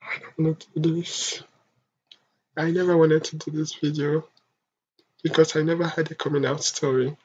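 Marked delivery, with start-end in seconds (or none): 0.0-1.4 choked, fighting tears; holding back tears. Real, not acted. Telling viewers about the pain, close to tears. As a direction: struggle to get the words out. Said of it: "I promised myself that I'm not gonna cry".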